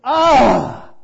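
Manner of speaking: loud, high cry of grief; demo wail. Shown as a demonstration. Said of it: "To cry out loud, to go".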